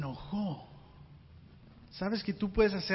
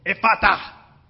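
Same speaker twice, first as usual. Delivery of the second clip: loud commanding cry; shout; 12 dB louder, slower than usual. Acted. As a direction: call out the healing word. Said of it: "y luego grita". (grito fuerte y autoritario)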